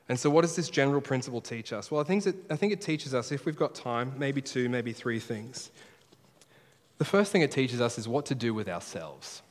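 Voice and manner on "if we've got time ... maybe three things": ordinary delivery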